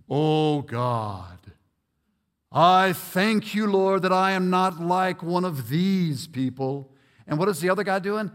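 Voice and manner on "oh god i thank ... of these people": pompous preacher prayer, loud and slow; pastor voice. Retold or imitated. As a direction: show off piously to the crowd. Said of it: "they're putting on the pastor voice".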